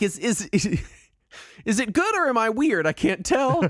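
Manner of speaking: high, puzzled inner voice; mock bewildered. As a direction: wonder aloud, baffled and laughing. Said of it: "I'm like".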